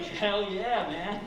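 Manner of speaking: high, loud cheer; mock thrilled. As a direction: cheer it with put-on excitement. Acted delight at crash scene.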